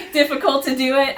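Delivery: laughing. Real, not acted. Admitting something scary.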